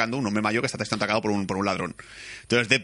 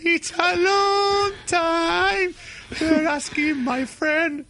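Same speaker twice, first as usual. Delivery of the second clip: very high, drawn-out put-on voice; lightly sung-sounding silly voice; hammed-up falsetto line; 4 dB louder, much slower than usual. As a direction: stretch each word in a mock falsetto. Acting out Hugh Jackman line.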